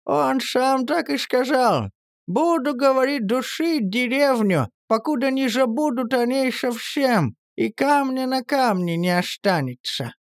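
put-on high, slow old village woman; high, slow old granny voice. A narrator's reading. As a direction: voice an old woman retelling a threat. Zosya voices Elizar threat.

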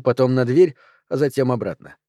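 plain reading voice

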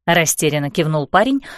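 ordinary delivery

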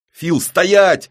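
high, loud halting yell. Acted. Syava yells at Fil to stop, loud and high. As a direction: stop him with a shout. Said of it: "орёт Сява".

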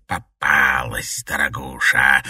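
low, slow hissing witch voice. A narrator's reading. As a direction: hiss it like a snake. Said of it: "прошипела Лорена".

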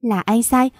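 usual speaking voice

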